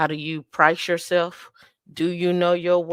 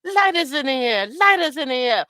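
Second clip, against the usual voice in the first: high, drawn Jamaican hype call; Jamaican dancehall; 8 semitones higher, 4 dB louder than usual. Acted. Host acting out the DJ, high Jamaican hype chant. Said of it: "when the D J be like".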